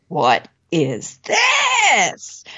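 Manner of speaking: drawn-out, wondering cry of amazement; amazed exclamation. Retold or imitated. Stunned guild visitor spotting the bowl, drawn-out amazed cry. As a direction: stretch it out, stunned by the find.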